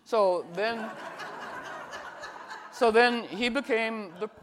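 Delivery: real laughter breaking through the words; laughing. Real, not acted. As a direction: laugh as you start the sentence. Laughs at her own cat story.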